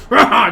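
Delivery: loud, high victory roar; orc roar. Acted. Orc winning the arm wrestle with a loud roar. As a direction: bellow as the fist slams down. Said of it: "kinda slams his hand down and goes".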